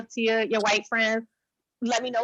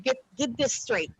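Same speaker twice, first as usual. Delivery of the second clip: ordinary talk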